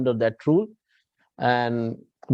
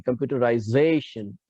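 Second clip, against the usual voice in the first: computerization with "za" accented and "rai" left light; stress on ZA only. Shown as a demonstration. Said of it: "Rai bhi और जे भी नहीं".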